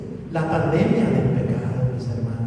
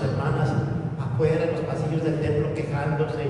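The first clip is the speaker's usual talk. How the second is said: ordinary talk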